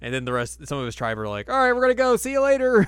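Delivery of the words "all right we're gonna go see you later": much higher, louder goofy wail; shrill it out like cartoon sidekicks; mock crying farewell; squeaky tribesmen. Voicing departing tribe.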